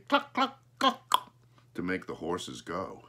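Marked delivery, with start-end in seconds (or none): 0.1-1.3 high bird clucking; sharp high mimicked clicks; crow's clucks. A narrator's reading. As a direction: cluck high like a crow starting horses. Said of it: "he let Smokey say".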